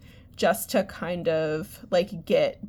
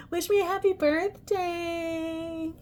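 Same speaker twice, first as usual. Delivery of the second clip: coy plea; 9 semitones higher, slower than usual. Pretend. Coy request, high slow.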